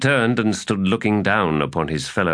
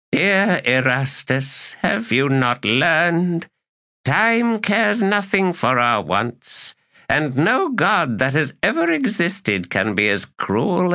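slow, higher and weary lament; weary elder god, slow; 11 semitones higher than usual. A narrator's reading. Knuckles to the errant, slow and weary. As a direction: mourn what time has taken, unhurried.

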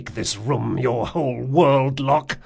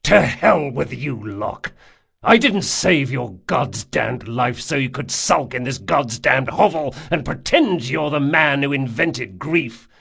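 heated, louder telling-off; angry rebuke; 3 semitones higher, 6 dB louder than usual. A narrator's reading. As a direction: lay into your friend angrily. Acted quarrel between two friends.

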